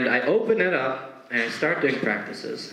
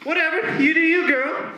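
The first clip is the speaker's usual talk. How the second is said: put-on very high dismissive teen voice; high mock teen brushing off the teacher; sassy teen; 14 semitones higher than usual. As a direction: toss it off like a bored sassy girl. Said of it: "Layla's answer".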